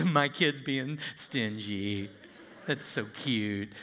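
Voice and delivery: mock God cooing fondly over a stingy kid; mock doting God. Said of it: "God looks down from heaven and goes".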